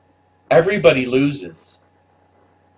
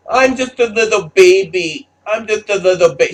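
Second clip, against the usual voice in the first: put-on higher, louder little-girl baby voice; mock her as a helpless little baby; mocking baby voice, pitched up; 5 semitones higher, 4 dB louder than usual. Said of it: "I'm gonna use my baby voice".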